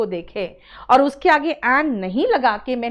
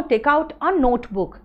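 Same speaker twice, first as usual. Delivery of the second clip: slow example reading; much slower than usual. Shown as a demonstration. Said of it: "अगर मैं कहूँ".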